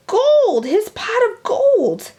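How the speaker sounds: high, loud shout for gold; excited shout. A narrator's reading. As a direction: yell it out greedy and thrilled. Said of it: "shouted B B".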